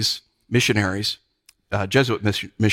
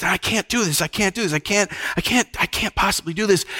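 higher, pleading repeated refusals; mock anguish, pitched up without shouting; acted angst; 7 semitones higher than usual. Tortured priest refusing to renounce his faith, in acted anguish. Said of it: "he's filled with angst".

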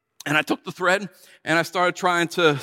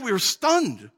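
ordinary talk

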